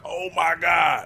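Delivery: slow, awed fan exclamation; a hint of a fan marking out. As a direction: gasp it out like a thrilled fan. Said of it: "I'm like".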